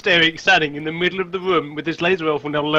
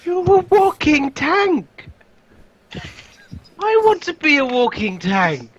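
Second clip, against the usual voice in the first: high, very slow gushing; awed excitement; 8 semitones higher, much slower than usual. Real, not acted. Player to the armoured guard, slow awed gush. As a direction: stretch it out in awe.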